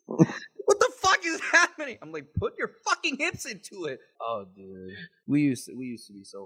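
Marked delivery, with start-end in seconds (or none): 0.1-2.0 high-pitched, drawn-out mock yell; retold yelling. SWAT veteran at rookies failing to breach a door, high laughing mock yell. 2.4-4.0 high-pitched mock exasperated yell; retold yelling. SWAT veteran coaching a rookie on the battering ram, high mock yell.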